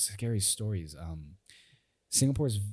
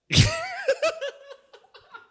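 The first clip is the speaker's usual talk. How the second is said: high helpless burst of laughter; laughing fit; 8 dB louder than usual. Real, not acted. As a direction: crack up loudly. Laughing fit mid story.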